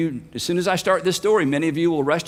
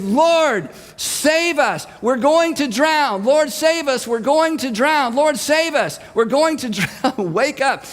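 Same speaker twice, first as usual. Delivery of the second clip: panicked yell; 6 semitones higher, slower than usual. Retold or imitated. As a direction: scream for help over the storm. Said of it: "You can't be heard on this boat unless you're yelling".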